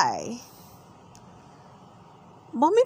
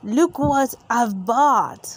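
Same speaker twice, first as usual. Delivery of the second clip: slow proud dad voice; lightly voiced Daddy Pig, slow; Daddy Pig showing off his chair, slow; 4 dB louder, slower than usual. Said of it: "snorts Daddy Pig".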